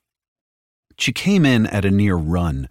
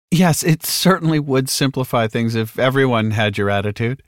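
beaming saleswoman; 7 semitones higher than usual. A narrator's reading. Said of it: "smile was practically blinding".

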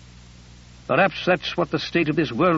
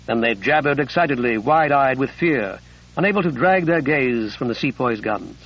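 ordinary delivery, nothing marked

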